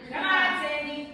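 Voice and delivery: loud summons; calling out. Acted. Calling Sandy to the booth, loud. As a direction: call across the room.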